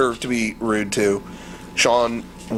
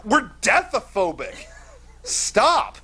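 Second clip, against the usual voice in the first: exasperated; 8 semitones higher, slower than usual. Retold or imitated. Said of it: "Derek goes".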